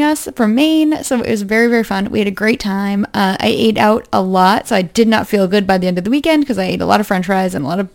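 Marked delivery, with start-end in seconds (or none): none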